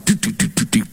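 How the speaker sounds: quick, light "doot" knocking noises; imitated knee knocking. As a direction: mimic knocking knees with a little sound effect. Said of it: "the king's knees are knocking".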